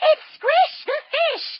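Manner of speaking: put-on high, bright-eyed reveal to the kids; cheerful high "It's Squish" for children; excited announcement. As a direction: announce him like a big surprise. Excited intro of the fish character.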